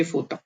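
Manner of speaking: फोता with the o reduced to a; फोता, not photo. Shown as a demonstration. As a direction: say फोता, ending in a clear a. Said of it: "ओ है वो आ की तरह pronounce".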